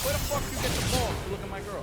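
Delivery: acted indignant demand; mock-angry challenge. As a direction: confront him like a jealous boyfriend. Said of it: "when they get angry".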